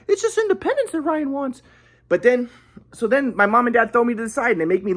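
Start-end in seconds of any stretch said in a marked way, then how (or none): 0.1-1.6 imitating grandpa. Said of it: "be like".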